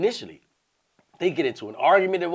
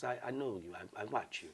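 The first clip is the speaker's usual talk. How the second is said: very quiet, lowered imitation; soft-spoken woman; 6 semitones lower, 19 dB quieter than usual. Woman telling him she watches him, real soft. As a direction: drop to a gentle hush. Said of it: "Real soft".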